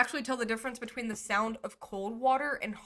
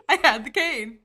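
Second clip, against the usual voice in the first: laughing loudly through the words; giggling delight at the memory; 6 semitones higher, 10 dB louder than usual. Real, not acted. Laughing recalling her costume.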